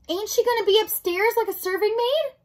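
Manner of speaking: put-on high, loud, disbelieving serving girl; dismayed question. Mig questions the rat's plan, high dismayed voice. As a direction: blurt it out in high-pitched dismay.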